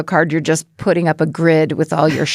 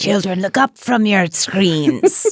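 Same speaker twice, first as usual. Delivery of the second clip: evil witch voice. Pretend. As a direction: beckon them like a wicked witch. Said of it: "You're good at that".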